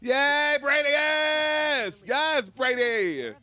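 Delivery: high squealing cheer for Brady; excited cheer. Real, not acted. Streamer welcoming friend Brady back with a high squeal.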